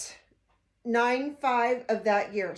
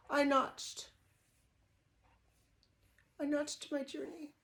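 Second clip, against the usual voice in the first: emotional; 3 semitones higher, 5 dB quieter than usual. Real, not acted. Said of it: "I'm sorry to get emotional".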